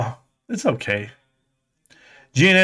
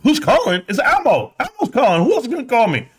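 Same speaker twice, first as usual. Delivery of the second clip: defensive husband; 10 semitones higher than usual. Retold or imitated. Husband answering wife's question about calls, high and defensive.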